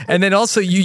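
ordinary talk, nothing marked

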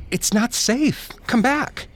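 high-pitched, loud shout; urgent shouted warning. A narrator's reading. Victoria calls the girl back from the roof's edge, shouting. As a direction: call out loudly across a distance, desperate to stop her. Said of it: "she shouted".

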